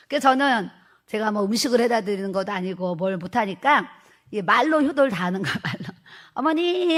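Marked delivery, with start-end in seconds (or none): 6.3-7.0 mother-in-law called in a high, sweet voice. Retold or imitated. Daughter-in-law sweetly calling her mother-in-law, high. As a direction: coax her with a sweetly raised "어머니". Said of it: "하면서 이제 효도를 다 했어요".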